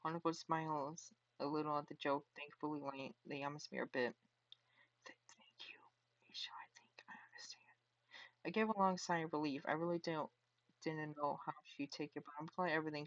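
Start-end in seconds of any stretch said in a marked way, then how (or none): 5.0-7.8 put-on shy girl whispering; timid whisper. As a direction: stammer it out in a soft whisper. Shy Hanako voiced by reader.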